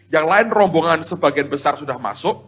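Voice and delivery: ordinary talk, nothing marked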